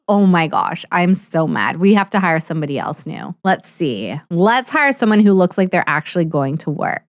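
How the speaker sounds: ordinary delivery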